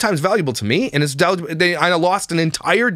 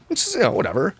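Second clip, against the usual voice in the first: normal talking voice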